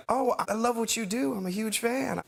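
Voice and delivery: high, gushing star impression. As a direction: pitch it up high and admiring. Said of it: "And he was like".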